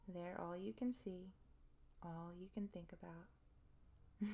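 slow soft hypnotic voice; hypnotic whisper. Acted. Hypnotic induction.

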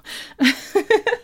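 high, breathy giggle; short embarrassed laugh. Real, not acted. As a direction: giggle as you break off. Breaks off her own performance.

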